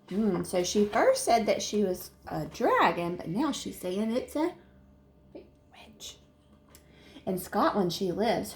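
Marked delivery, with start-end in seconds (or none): 5.3-6.2 breathy single word; whisper. Acted. Narrator whispers witch to the listener. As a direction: spook them with the word.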